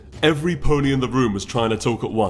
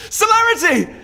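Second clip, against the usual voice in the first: much higher and louder, building cry; calling out desperately; 9 dB louder than usual. Acted. Princess crying out after her departing student, desperate call. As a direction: call after her as she leaves, rising.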